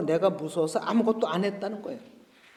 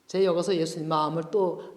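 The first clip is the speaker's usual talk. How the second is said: ordinary talk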